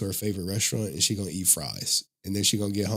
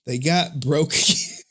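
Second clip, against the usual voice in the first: laughs midway, louder; amused retelling of the broken lights; laughing; 6 semitones higher, 4 dB louder than usual. Real, not acted. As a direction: crack up as you repeat it. Repeating the mishap.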